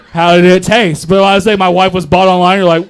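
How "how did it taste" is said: usual speaking voice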